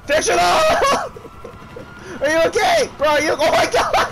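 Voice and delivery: high-pitched frantic shouts; panicked shouting. Real, not acted. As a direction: call out in alarm, voice shooting up. Frantic yelling at teammates.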